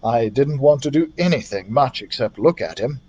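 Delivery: plain reading voice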